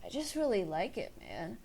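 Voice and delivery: mellow, lazy stoner, fading out. Shown as a demonstration. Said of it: "when you speak like this".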